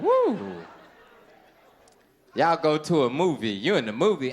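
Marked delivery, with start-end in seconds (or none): 0.0-0.4 loud, very high whoop; high woo. Acted. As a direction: whoop with lust. Lustful exclamation.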